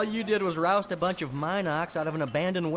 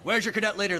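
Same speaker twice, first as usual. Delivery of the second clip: commando asks; 5 semitones higher, 6 dB louder, faster than usual. Acted. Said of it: "the Special Ops guy asks".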